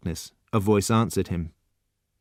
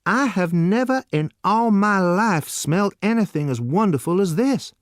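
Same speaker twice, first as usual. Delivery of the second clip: crying out in delight; 9 semitones higher than usual. A narrator's reading. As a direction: savour every word. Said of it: "Lexington cried".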